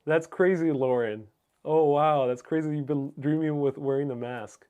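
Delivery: ordinary talk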